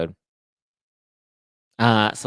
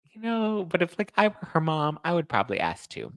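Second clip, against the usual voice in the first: ordinary delivery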